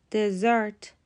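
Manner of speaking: "دزرت" said de-ZERT; stress on second syllable. Shown as a demonstration. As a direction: hit the end of "دزرت", de-ZERT. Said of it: "استرس روی سیلاب دومه".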